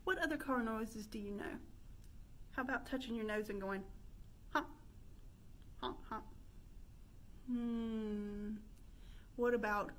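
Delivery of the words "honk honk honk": ordinary talk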